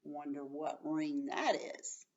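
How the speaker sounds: ordinary talk, nothing marked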